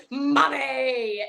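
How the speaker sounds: mock childish "Mommy"; lightly voiced mimicry of a child; grown man imitating the kid. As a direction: copy the boy's call, playfully. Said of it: "then Ryan goes".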